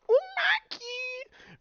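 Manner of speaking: high, slow drawn-out call of the name; drawn-out happy cry. Real, not acted. Streamer greeting friend Maki, high and slow.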